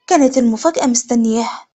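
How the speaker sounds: ordinary delivery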